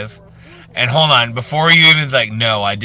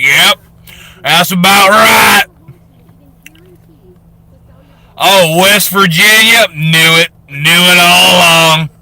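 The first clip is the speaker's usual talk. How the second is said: louder, higher, slow smug call-out; mock smug know-it-all; 7 semitones higher, 9 dB louder than usual. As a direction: ham up the I-knew-it gloat. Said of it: "you're like".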